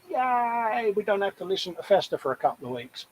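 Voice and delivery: high-pitched imagined listeners rejoicing; mock cheering. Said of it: "there's people out there that'll be going".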